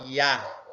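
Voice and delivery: "Iá" com A aberto, como agudo. Shown as a demonstration. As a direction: abra bem o A de "Iá". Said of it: "acento agudo nele". (Iá com A aberto)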